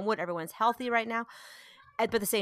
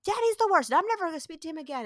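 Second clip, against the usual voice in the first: high-pitched mock sulky tirade; dramatic child; 8 semitones higher than usual. Mom voices her son mad at daddy, dramatic imitation. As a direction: imitate a kid declaring war, pitched up. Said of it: "So he was like".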